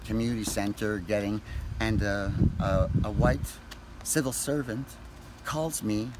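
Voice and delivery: usual speaking voice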